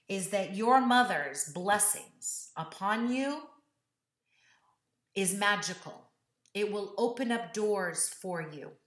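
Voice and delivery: slow, sincere moral; heartfelt lesson. Acted. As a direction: preach the lesson slowly. Delivers life lesson.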